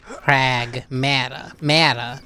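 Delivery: Pragmata drawn out slowly, Mata with a short "a"; demonstrated pronunciation. Shown as a demonstration. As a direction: spell out Pragmata slowly as prag-MAT-a. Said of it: "the way that they say it".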